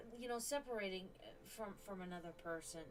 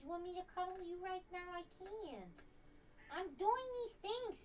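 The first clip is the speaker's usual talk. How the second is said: pet talk; 8 semitones higher than usual. Real, not acted. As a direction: tease the cat sweetly. High baby talk to cat.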